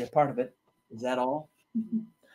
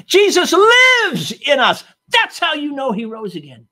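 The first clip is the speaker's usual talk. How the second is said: high, loud, drawn-out "Jesus lives in us"; triumphant proclamation; 11 semitones higher, 11 dB louder than usual. Acted. Preacher echoing the wife's answer to the congregation, high and loud. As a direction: ring it out slowly.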